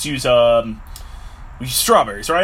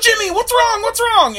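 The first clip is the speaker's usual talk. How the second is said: very high, louder frantic pleading; frantic worried cry; 3 dB louder than usual. Acted. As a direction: shriek it at a friend in shock. Friends alarmed at Jimmy.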